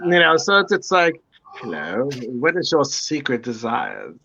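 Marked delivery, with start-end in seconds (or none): 1.6-4.1 put-on low, soft British seducer's accent; Tom Ellis accent. Showing friends his accent, asking secret desires, Tom Ellis style. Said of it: "Tom Ellis accent".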